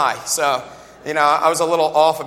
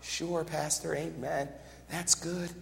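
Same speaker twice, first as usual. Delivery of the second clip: very soft, lifeless agreement; faint, going-through-the-motions churchgoer; half-hearted nodding along; 17 dB quieter, slower than usual. Acted. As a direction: mumble polite assent without listening. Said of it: "They just nod me".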